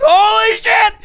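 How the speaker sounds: very high, loud shocked yell. Retold or imitated. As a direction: scream it as fuel pours out. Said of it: "And I'm like".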